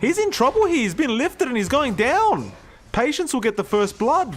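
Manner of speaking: high, ringing esports kill call; excited play-by-play. Real, not acted. Caster calling first blood live, excited play-by-play. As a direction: soar up in pitch as the kill unfolds.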